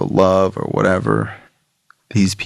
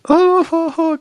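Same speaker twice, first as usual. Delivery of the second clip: high mock wail; imitated crying. Man hugged in the parking lot, mock crying. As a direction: bawl in a high, put-on cry.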